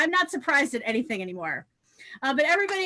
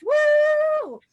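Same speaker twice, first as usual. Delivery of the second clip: high, loud whoop to cheer on the comic; hype whoop; 13 semitones higher, 7 dB louder than usual. Real, not acted. Host whooping as the next comic is introduced, loud and high. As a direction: whoop it up for the crowd.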